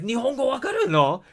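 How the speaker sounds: high, loud astonished squeal in Japanese; shocked question. Retold or imitated. Translator discovering he speaks Japanese, high astonished squeal. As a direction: shriek it like she just caught you out. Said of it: "she's like".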